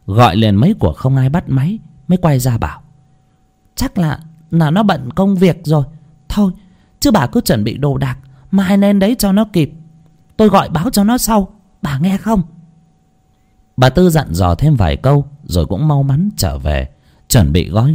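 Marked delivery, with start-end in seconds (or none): 3.8-12.4 put-on high, practical old-neighbor voice; higher-pitched, busy reassurance; neighbor, briskly instructing. A narrator's reading. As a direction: sum it up firmly for someone hard of hearing.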